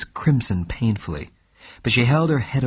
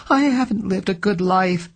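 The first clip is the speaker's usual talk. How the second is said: crying out; 9 semitones higher than usual. A narrator's reading. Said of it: "she cried".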